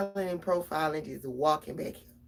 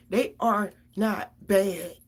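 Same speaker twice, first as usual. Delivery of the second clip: slow, loud and deliberate, every word weighed; slow insistence; 8 dB louder, much slower than usual. Real, not acted. A mother insisting to viewers her kids aren't bad, slow and emphatic. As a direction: lean hard on each word, slowly.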